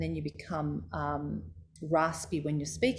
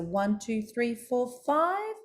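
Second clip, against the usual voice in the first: climbing pitch; 5 semitones higher, much slower than usual. Shown as a demonstration. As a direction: count stepping up to a high last number. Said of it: "and up the stairs".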